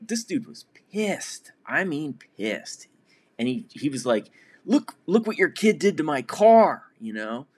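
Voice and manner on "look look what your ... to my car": higher and louder, agitated near-yell; not quite yelling but heated; animated angry complaint. Retold or imitated. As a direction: confront the dad about the dented car. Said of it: "He wasn't yelling, but he wasn't talking in a regular voice either".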